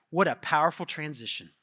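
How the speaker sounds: ordinary talk, nothing marked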